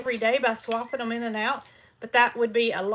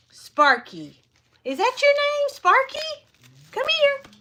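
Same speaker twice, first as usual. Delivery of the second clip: much higher sing-song coaxing; calling the goose; 6 dB louder than usual. Real, not acted. Calling Sparky the goose over, high and coaxing. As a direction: coo his name to lure him over.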